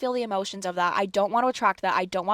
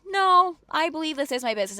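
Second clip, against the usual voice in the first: high, loud no, then brisk; defiant retort; 7 semitones higher, 3 dB louder than usual. Retold or imitated. Tenant telling the uncle it is her business, defiant. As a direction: fire back an acted, pointed objection.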